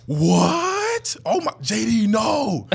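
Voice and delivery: hammed-up gasping outrage, like the whole locker room; mock shocked outcry. Teammates reacting to the instigator, loud mock-shocked cry.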